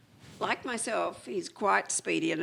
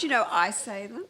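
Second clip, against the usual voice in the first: ordinary delivery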